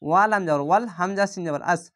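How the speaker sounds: usual speaking voice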